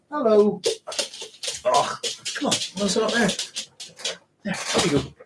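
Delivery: very high greeting to a pet; fond pet talk. Real, not acted. Streamer greeting and coaxing the dog, fond pet talk.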